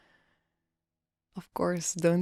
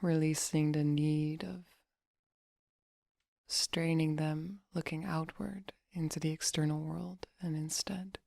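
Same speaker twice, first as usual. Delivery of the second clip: soft, unhurried meditation voice; guided meditation; 3 dB quieter than usual. Acted. Meditation coach relaxing listeners' face and jaw, soft guiding voice. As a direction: guide them gently into relaxation, leaving long pauses.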